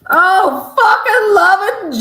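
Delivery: loud, high laugh-cry through the words; howling with laughter, slowed; laughing in delight. Real, not acted. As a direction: crack up with glee while talking. Delighted reaction to the clip.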